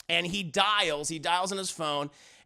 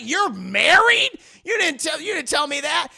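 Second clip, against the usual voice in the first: loud, high mock disbelief; acted shock; 7 semitones higher, 8 dB louder than usual. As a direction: blurt it out as the shocked lover. Retold lover learns she is married.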